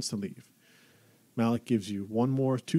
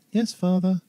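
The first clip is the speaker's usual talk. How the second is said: put-on timid boy voice; 9 semitones higher, 7 dB louder than usual. Son answers stern father.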